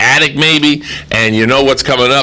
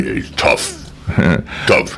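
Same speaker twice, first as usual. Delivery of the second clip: a breathy tough-guy "Tough"; tough Rambo voice; 6 dB quieter, much slower than usual. Retold or imitated. Host answers how Rambo talks, with a tough breathy growl. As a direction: push out "Tough" like a gruff action hero. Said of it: "How does Rambo talk like that".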